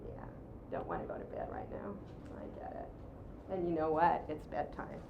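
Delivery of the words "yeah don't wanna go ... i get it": low, soft murmur of empathy; soft mirroring. Shown as a demonstration. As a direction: say it softly, like you get it. Mirroring a child's bedtime protest.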